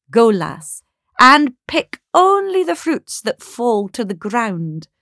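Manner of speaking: loud, rising character voice; September's commanding voice. Acted. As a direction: send the girl off with authority. September commands the girl in story.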